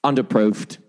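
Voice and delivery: a Great British Bake Off judge in a British accent; mock British accent, Bake Off style. Shown as a demonstration. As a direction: try a British accent as a joke. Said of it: "here's my British".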